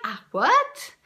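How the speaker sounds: high, startled double what; stunned disbelief. Retold or imitated. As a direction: caught off guard, voice jumps up. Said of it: "It took me aback".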